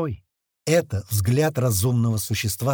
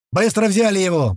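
louder, higher command; repeated urgent order; 6 semitones higher, 5 dB louder than usual. A narrator's reading. Doctor ordering men to lift the patient, urgent command. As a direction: push them to move now. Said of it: "повторил Док".

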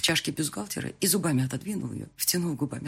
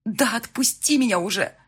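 frustrated; 6 semitones higher, 6 dB louder than usual. Acted. Struggling to get free.

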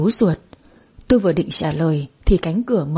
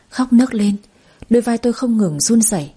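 ordinary delivery

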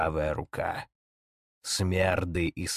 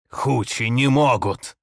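frightened shout; 5 semitones higher, 7 dB louder than usual. Acted. Denying the dead speak.

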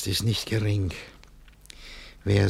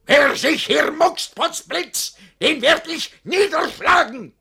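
very high, loud, slow menacing roar; furious threat; 8 dB louder, slower than usual. Acted. Master threatening to knock down anyone who moves. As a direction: threaten to strike them down.

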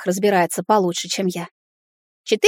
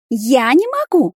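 slow, higher pouting whine; whiny spoiled pouty drawl; 5 semitones higher, 4 dB louder, slower than usual. Acted. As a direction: pout and drag it out. Elf pouts, refuses.

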